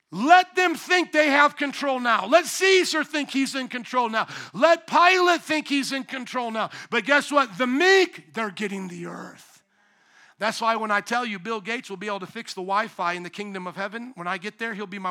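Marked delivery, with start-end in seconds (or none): 0.1-9.6 louder, higher, building proclamation; fiery preaching. Real, not acted. Preacher rousing the congregation, loud and building.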